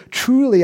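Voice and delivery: high, loud "Truly"; Jesus declaring "Truly"; proclaiming. Acted. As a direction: announce it with weight. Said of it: "when you see".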